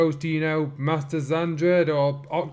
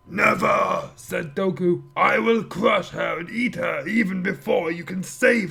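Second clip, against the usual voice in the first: barbarian; 4 semitones higher than usual. Acted. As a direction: roar it like a hungry brute. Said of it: "villain Dokoku voiced".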